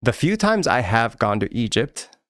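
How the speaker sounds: normal talking voice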